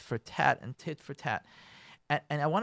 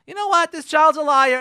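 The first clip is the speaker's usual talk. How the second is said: much higher, louder outburst; exasperated teacher; 11 semitones higher, 11 dB louder than usual. Acted. Teacher fed up with lying pupil, indignant outburst. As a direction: blurt it out in indignation.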